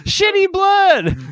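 very high, slow, loud yell; hammed-up drawn-out holler; mock stage shouting. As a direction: belt it out like a parody of a comic. Mimics friend's bit on stage.

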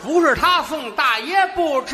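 hammed-up stubborn old man; fussy old man. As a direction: whine it like a spoiled old man. Old man refusing delivery.

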